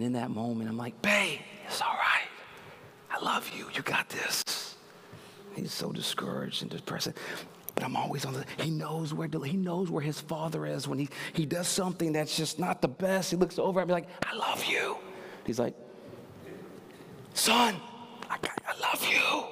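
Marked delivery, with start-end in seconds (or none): 1.0-4.8 soft whispered reassurance. Retold or imitated. Father whispering to his son after a miss. 14.3-15.0 soft whispered reassurance. Retold or imitated. Father whispering to his son after a miss. 17.3-19.5 whisper. Retold or imitated. Father whispering to his son after a miss.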